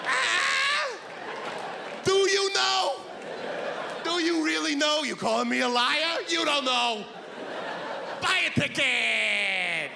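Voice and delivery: high, teasing lottery pitchman; taunting slogan voice. Acted. Lottery slogan personified, taunting the audience, high and teasing. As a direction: needle them until they buy a ticket.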